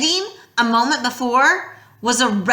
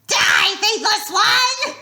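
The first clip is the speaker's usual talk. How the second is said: raspy shout; 12 semitones higher, much slower than usual. Acted. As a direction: scream the death sentence. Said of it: "Echidna rasped".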